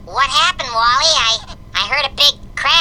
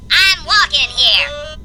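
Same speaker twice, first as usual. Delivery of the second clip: ordinary delivery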